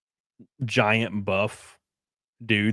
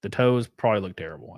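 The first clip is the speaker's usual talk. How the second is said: usual speaking voice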